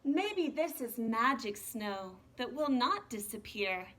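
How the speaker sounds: soft, unhurried storybook reading; reading to children. Acted. Teacher reading the snowman book to her class, soft storytelling. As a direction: read the picture book gently to the kids. Reads picture book aloud.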